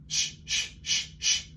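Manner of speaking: voiceless sh bursts; breath only; whispered sh. Shown as a demonstration. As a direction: push air out in short shushes. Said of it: "diaphragm warm up".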